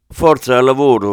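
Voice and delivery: loud, brisk command to get to work; barked order. Acted. Doria orders his two men to start, loud command. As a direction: snap the men into action. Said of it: "ordinò Doria".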